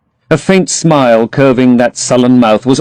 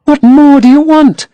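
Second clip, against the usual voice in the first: put-on higher, dreamy young girl; Sybil in love; 5 dB louder than usual. A narrator's reading. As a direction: gush about her lover to her brother.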